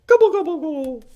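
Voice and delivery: very high, loud gobbling call; turkey gobble. Pretend. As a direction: squawk like a turkey to end the song. Song ends in turkey call.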